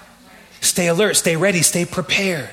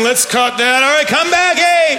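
calling out; 6 semitones higher, slower than usual. Retold or imitated. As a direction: wave everyone back in. Cutting the livestream.

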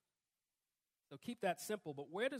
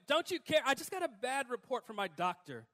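high, loud pleading to God; half-laughing mock plea; 5 semitones higher, 7 dB louder than usual. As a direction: beg God with a touch of whining. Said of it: "Or, you know".

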